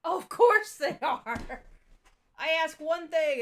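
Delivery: loud, slow exasperation; exasperated loud. Real, not acted. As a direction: throw up hands in frustration. Exasperated at confusing comic debuts.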